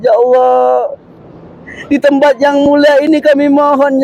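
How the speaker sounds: impassioned supplication, rising and pleading; intoned doa with long drawn ya Allah; heartfelt prayer. Acted. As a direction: lead the group in prayer, voice lifting on each plea. Guide leads a pilgrims' supplication.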